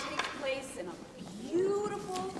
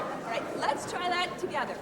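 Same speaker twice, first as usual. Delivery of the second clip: ordinary delivery, nothing marked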